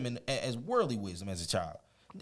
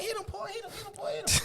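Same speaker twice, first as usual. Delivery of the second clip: sisters cheering. Retold or imitated.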